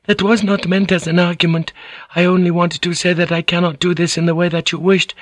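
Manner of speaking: high, anxious pleading; high feminine rush of excuses; gushing apology. A narrator's reading. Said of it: "the words gushing".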